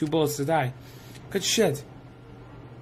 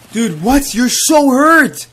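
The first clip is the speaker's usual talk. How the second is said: high, loud disbelieving protest, stretched out; exasperated shout; 8 semitones higher, 9 dB louder, slower than usual. Real, not acted. Gamer's wounded target survives, exasperated shout. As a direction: wail it at an enemy who won't die. Enemy survives his shots.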